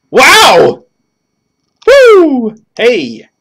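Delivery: high loud cartoon whoops; cartoony exclamations. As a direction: yell it like a cartoon character. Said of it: "let's read it in a cartoony voice".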